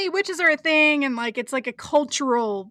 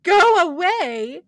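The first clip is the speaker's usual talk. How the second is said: mock exasperation; 3 semitones higher, 5 dB louder than usual. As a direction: shoo off a pest, lightly hammed up. Acting Fiona shooing the cat.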